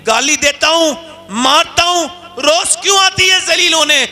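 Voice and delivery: chaudhry baffled she keeps coming back; rising exasperation. Acted. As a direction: build from a question to a disbelieving cry. Chaudhry asks why she returns.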